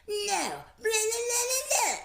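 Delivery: very high mock wailing gibberish; passionate child's tantrum. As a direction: imitate a kid's shrieky, passionate rant. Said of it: "When she feels very passionately about something".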